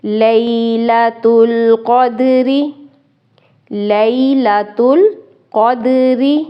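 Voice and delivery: slow chanted tajweed recitation; Quran recitation. Shown as a demonstration. Teacher recites the whole verse for students. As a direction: recite the verse slowly and melodically.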